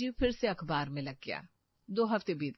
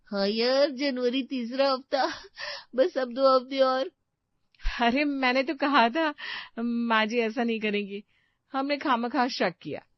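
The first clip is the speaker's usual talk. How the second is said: louder, higher, cheerful relief; happy excitement; 5 semitones higher, 6 dB louder than usual. Acted. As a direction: celebrate the news. Daughters-in-law rejoicing. (ख़ुशी से चहकती आवाज़)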